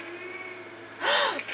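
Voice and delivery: high, sharp gasp; sudden intake of breath in alarm; startled gasp. Real, not acted. Gamer spotting a witch, startled gasp.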